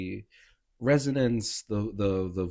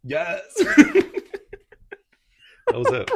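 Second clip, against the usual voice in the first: very high whoop breaking into laughter; delighted cheer; 10 dB louder than usual. Real, not acted. Cheering a friend's action hero pose, delighted cheer. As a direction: squeal it high, then laugh loudly.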